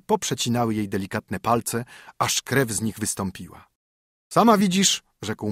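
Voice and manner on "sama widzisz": wyżej i głośniej, pouczający mąż; surowy mąż. A narrator's reading. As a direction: rozkazuj żonie jak zmęczony gospodarz. Husband reproaches her. (mąż pouczający żonę, głośniej i surowo)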